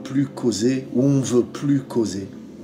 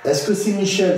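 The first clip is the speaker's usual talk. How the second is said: high, raised voice asking the room; calling out to spirit; 9 semitones higher than usual. Real, not acted. Investigator calling to the spirit, raised voice. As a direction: call out to whoever is there.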